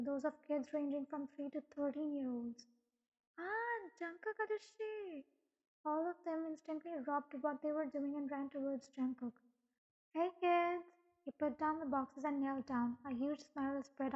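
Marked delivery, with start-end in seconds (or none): 3.4-5.2 put-on high, drawn-out childlike cheer; delighted child's call. A narrator's reading. Kids spot Jungkook arriving, calling out in delight. 10.1-10.9 higher, louder greeting; cheerful call. A narrator's reading. Jungkook greets the children running to him, calling out.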